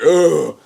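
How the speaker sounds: imitated groan of held-in agony; mock pained groan. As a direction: groan without screaming. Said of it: "He never really starts screaming".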